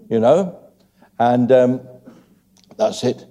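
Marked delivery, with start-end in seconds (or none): none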